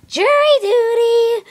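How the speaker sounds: high, loud demand; whiny yell. Acted. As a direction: wail out the show name. Demanding tantrum.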